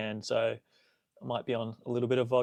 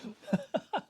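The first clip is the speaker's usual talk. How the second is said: soft high-pitched giggle; a light breathy giggle; 5 dB quieter than usual. Real, not acted. Laughing at hangover joke.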